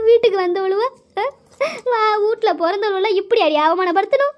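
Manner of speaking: high, sobbing lament; high-pitched crying complaint; tearful wailing. Acted. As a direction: wail out grievances through tears. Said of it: "எதுக்கு இப்ப நீ அழுவுற".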